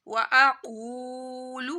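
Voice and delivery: slow tajweed reading of و اقول; heavy qaf, long waw. Shown as a demonstration. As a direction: stretch the oo of اقول and give قاف a thick throat sound. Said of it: "واو ساکن سے پہلے پیش آ رہی ہے تو واو مدہ".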